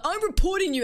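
high, loud mock report threat; spiteful teammate yell. Teammates threatening to report her, mock yell. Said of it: "And then they're like".

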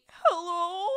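imitating a tearful hello, drawn out; mock crying hello. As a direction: fake a long teary greeting. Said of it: "the crying".

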